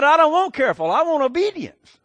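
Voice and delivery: high, loud, firm scolding; stern mother. Retold or imitated. Mother scolding her son, high and loud. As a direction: lay down the law to the child. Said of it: "She said".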